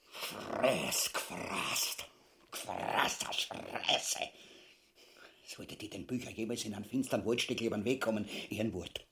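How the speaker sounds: low, soft, whispered threat; menacing whisper. Acted. Ubu to his wife, whispering a threat against the king. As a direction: hiss a promise of violence.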